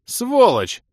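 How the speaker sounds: high, loud hurled insult; angry shout. Acted. Влас abuses his friend by phone, shouting. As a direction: yell it down the line.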